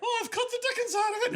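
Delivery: put-on high, fast warbling Julia Child; shrill cooking-show host, hammed up. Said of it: "Aykroyd was Julia Child".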